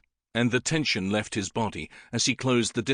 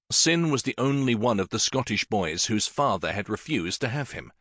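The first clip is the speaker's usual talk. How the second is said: ordinary delivery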